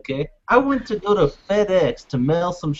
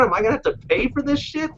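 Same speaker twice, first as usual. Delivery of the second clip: ordinary talk, nothing marked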